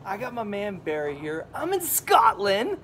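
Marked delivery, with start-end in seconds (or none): 1.5-2.8 excited. Real, not acted. Hyped intro announcing location.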